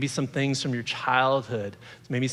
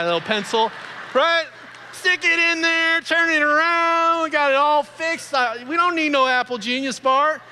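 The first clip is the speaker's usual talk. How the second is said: much higher and louder, hammed-up glee; excited show-and-tell; 14 semitones higher, 5 dB louder than usual. Speaker to the crowd, showing off the pencil fix, hammed-up excitement. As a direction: play it up like a proud old-timer fixing his tape.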